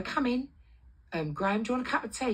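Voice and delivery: high, singsong invitation; retold over-friendly welcome; sweet hostess. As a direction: play nice to a visiting boss.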